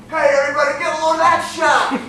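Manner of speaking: loud, high mock call to the crowd; mock announcing. Imagined dad calls everyone to look at the bad shot, loud mock shout. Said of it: "I felt like he was saying".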